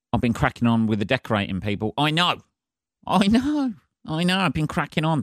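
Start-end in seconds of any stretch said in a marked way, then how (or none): 2.0-5.2 mock exasperated. Real, not acted. Mock self-reproach repeated.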